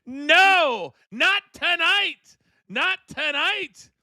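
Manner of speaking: high, slow, drawn-out denial; wrestling commentator. Real, not acted. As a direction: stretch out the not tonight. Near-fall called live.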